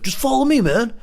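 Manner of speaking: very high mimicking voice; put-on stranger's voice. Stranger getting the truck driver to follow, high put-on voice. Voicing stranger urging him along.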